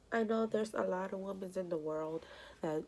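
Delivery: normal talking voice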